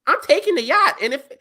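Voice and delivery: high, loud, fast; excited as the idea grows; excited rant. Real, not acted. Friend says he'd take the yacht, excitedly. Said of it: "excited rant".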